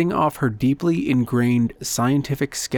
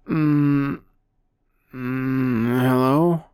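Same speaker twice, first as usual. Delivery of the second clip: groggy, just woken up; sleepy hoarse voice; much slower than usual. A narrator's reading. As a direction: mumble it half asleep. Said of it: "a hoarse, sleepy voice".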